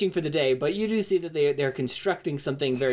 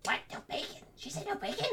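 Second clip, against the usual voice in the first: upset dwarf; 8 semitones higher, 4 dB quieter, faster than usual. Acted. Dwarf upset about bacon.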